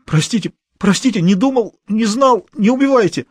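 a man on the floor begging the boss not to kill him; hysterical plea. A narrator's reading. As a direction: plead in panic.

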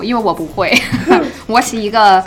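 normal talking voice

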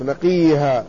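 نقيها pronounced naqiha, with a long i; kasra on qaf. Shown as a demonstration. Lecturer shows the corrected reading, نقيها said naqiha. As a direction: show نقيها with i after the q and no b. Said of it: "يعني بكسر القاف".

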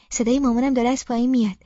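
usual speaking voice

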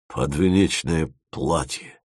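low, slow, embarrassed Dima; bashful repeat. A narrator's reading. Bashful Dima repeats his request to Timofeev, low and embarrassed. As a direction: repeat it slowly and sheepishly. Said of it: "повторил Дима и зарделся".

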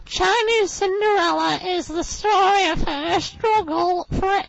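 put-on very high, slow squeaky girl's voice; high, slow mock-reading voice; impression of Beverly. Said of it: "she speaks like this".

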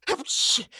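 high, quick stage sneeze; acted sneeze. A narrator's reading. Robber sneezes before suggesting another egg, acted sneeze. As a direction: burst out with a sneeze. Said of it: "чихнув".

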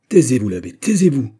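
loud, high shout to silence him; Fouquet's cry. A narrator's reading. Fouquet shouts down his brother the abbé, loud and furious. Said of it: "cria Fouquet".

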